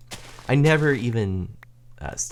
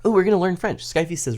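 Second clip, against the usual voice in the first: normal talking voice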